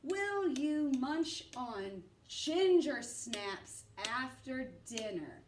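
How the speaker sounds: slow, careful read-through; slow test read. Shown as a demonstration. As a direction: read it slowly to check. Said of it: "Let me check myself".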